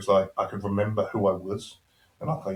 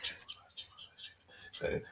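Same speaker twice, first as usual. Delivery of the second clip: whispered rehearsal, much softer; repeating under breath; 18 dB quieter than usual. Retold or imitated. Patient cramming the ward name before the doctor arrives, whispered. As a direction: whisper it over and over to remember.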